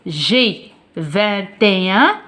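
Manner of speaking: "vingt et un" slowly, the t carried into "et"; liaison demonstrated. Shown as a demonstration. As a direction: draw out "vingt et un", linking each word. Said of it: "Il faut lire avec la liaison". (liaison lente et appuyée sur « vingt et un »)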